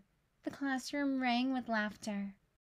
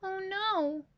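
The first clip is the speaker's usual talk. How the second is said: wailing; 7 semitones higher than usual. Acted. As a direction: wail it in comic despair. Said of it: "wailed Missus Hartwell".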